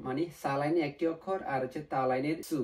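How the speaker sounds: ordinary delivery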